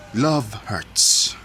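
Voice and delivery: usual speaking voice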